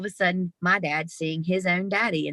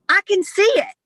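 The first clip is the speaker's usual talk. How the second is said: much higher and louder, a burst of wonder; father's sudden words; 7 dB louder than usual. Retold or imitated. Dying father reaching up, suddenly speaking, high awed exclamation. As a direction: let the mute father suddenly cry out in awe. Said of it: "he reaches up and he goes".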